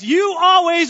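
loud accusing "You always". Shown as a demonstration. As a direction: say "You always" like a charge against your spouse. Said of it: "I'm gonna say the phrase".

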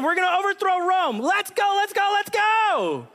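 high, rising excitement building to a shout; pumped-up followers ready to storm Rome; giddy crowd chanting let's go; excited disciples. Retold or imitated. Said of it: "they're sitting there going".

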